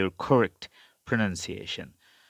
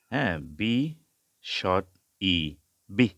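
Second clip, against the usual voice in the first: ordinary delivery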